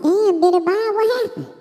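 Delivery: mother dog voice. Acted. Mother dog asks Billy Bob what happened, high and startled. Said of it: "the mother dog said".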